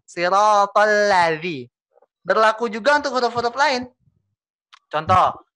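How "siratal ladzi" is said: slow siratal ladzi with a full la before zi; perfected la. Shown as a demonstration. Teacher models the corrected recitation, slow. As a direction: complete the la, then zi. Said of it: "Tapi sempurnakan".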